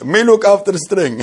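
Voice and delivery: half-laughing imitation of the neighbour; laughing quote. Real, not acted. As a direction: quote him while breaking into real laughter. Quoting the man through laughter.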